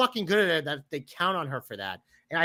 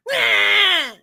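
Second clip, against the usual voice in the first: high, loud shouted roar; angry fan roar; 10 dB louder than usual. Acted. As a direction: bellow like an outraged fan. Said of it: "a pretty good enactment".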